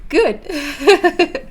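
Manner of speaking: high, giggly relief; pleased, high and laughing; delighted giggle. Real, not acted. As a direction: break into a real giggle on the word. Pleased that he liked it.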